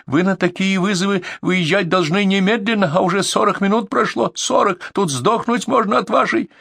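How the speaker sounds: high furious complaint; shrieking. A narrator's reading. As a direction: rant at full pitch. Caller screaming complaint.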